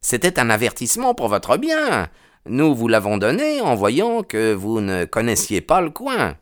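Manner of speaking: smooth over the stranger's anger; placating policeman. Acted. Policeman calming the angry stranger, high voiced.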